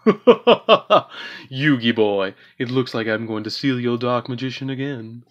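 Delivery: slow, theatrical cartoon villain voice, laughing; Pegasus impression. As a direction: ham it up like a smug anime duelist. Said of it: "Pegasus impression".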